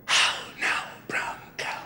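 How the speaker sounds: whispering the test phrase back to the doctor; whispered back. Retold or imitated. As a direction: whisper it back just as softly. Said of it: "I want you to repeat it".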